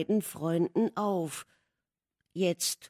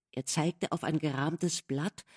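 plain reading voice

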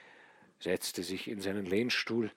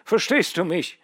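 louder, higher, feverish commands; feverish insistence; 7 semitones higher, 11 dB louder than usual. A narrator's reading. Dying son ordering father to send for Odinzow, loud and feverish. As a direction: rattle off orders through the fever.

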